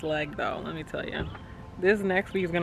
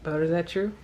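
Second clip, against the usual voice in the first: ordinary talk, nothing marked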